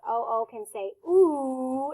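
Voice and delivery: "ooh" held long with rounded lips; modeling ooh. Shown as a demonstration. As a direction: draw out "ooh" as in zoo. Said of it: "O O has two different sounds".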